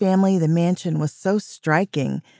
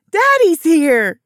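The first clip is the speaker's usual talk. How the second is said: high, slow excited shriek; child's shriek; 12 semitones higher, 6 dB louder, slower than usual. A narrator's reading. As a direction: shriek it like a thrilled little girl. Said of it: "shrieked Cece".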